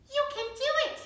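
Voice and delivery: high, tiny encouraging mouse; squeaky mouse voice. Acted. Mouse whispering encouragement to the diva, high and squeaky. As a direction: cheer her on like a little mouse.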